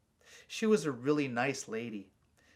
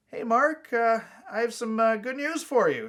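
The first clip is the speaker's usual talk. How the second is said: higher, louder brother voice, hesitant; Donnie, nervous; 8 semitones higher, 7 dB louder than usual. A narrator's reading. Brother hesitantly announcing good news, louder and higher. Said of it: "He eyed me nervously".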